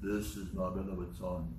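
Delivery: low, slow, hushed voice of God; solemn movie-star God, pitched well down; Charlton Heston. Retold or imitated. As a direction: intone it deep and slow, softly. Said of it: "Maybe like Charlton Heston".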